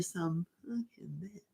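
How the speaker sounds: hushed, fierce swear; whisper. Real, not acted. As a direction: whisper it like a confession. Private aside about the other model.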